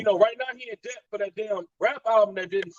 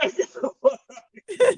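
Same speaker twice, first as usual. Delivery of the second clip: laughing dismissal; 8 semitones higher, 5 dB louder than usual. Retold or imitated. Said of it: "I'm like".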